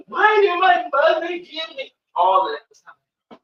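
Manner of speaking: high, loud imitation of a distraught child; anguished cry. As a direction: wail the question out in hurt. Client's anguished question about her mother.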